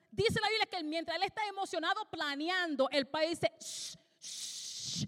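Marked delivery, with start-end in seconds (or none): 3.6-5.1 soft unvoiced shh; a breathy shushing sound; whispered shushing. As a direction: hush him like a parent. Father hushing excited Peter.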